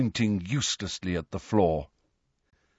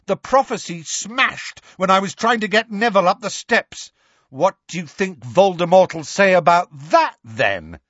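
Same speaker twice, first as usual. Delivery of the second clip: raised, pressing jeer; defiant taunt; 8 semitones higher, 4 dB louder than usual. A narrator's reading. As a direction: throw it at the enemy. Harry taunts Bellatrix mid-duel.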